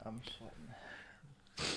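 breathless whisper, mouth on fire; mouth burning from spicy food. Real, not acted. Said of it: "Your lips are burning".

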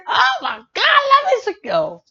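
high excited shout; excited fan shout. Real, not acted. Fan shouting her love for [name], high and excited. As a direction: squeal your love for the singer.

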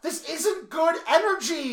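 high, loud, slow wail; hammed-up dramatic lament; anguished mock protest. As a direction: stretch the complaint into a wail.

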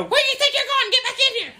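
high-pitched hollering coach voice; angry coach. Acted. Coach calling runaway kids from the window, high holler. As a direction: call them back in, strained and high.

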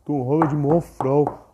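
lightly mocking, scornful of a hero film; imitating his old dismissive self. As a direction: brush it off with mild scorn. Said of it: "falei de novo".

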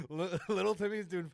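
giggling mid-sentence at his own bit; laughing. Real, not acted. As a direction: crack up while telling the joke. Cracking up at own joke.

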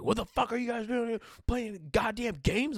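high-pitched mock irate scolding; exasperated, high retold telling-off; angry manager. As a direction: imitate a store manager cussing out kids. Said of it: "he's cussing at us".

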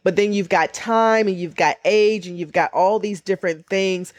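normal talking voice

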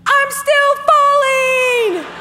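high, slow, drawn-out cry; falling and panicking, stretched high; panicked yell. Retold or imitated. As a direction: stretch it out as you keep falling. Mid-fall panic.

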